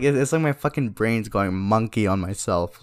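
usual speaking voice

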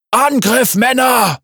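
high loud shouted command; rally the men to charge; battle cry. A narrator's reading. Carbonius orders his men to attack, shouting. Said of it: "rief Carbonius".

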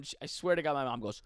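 normal talking voice

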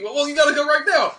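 loud, high-pitched order; urgent coach. Retold or imitated. Coach rushes cookie-eater to the start, urgent. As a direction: imitate a coach hurrying someone along.

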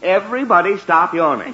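loud, emphatic command; exasperated household decree; stern order. Acted. Father orders family to stop.

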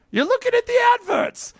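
very high mock British shout; Monty Python voice. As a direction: yell it in a squeaky Python sketch voice. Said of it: "What's the old Monty Python skit?".

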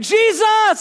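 very high, loud yell; defiant shout. Acted. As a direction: yell the name out, ignoring the hushers. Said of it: "He just shouts more".

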